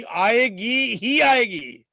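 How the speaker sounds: आएगी ही आएगी drawn out slowly, leaning on each word; emphatic insistence. Real, not acted. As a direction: stretch आएगी ही आएगी as an absolute certainty. Insists income is certain to come. (आएगी ही आएगी पर धीमा, खिंचा हुआ ज़ोर)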